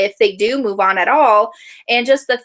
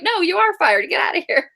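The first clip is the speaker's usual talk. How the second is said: higher, insistent mock firing; mock boss firing; 9 semitones higher than usual. Imitating Faye insisting the worker is fired, mock bossy. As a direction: throw him out with a bossy bark. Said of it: "and she's like".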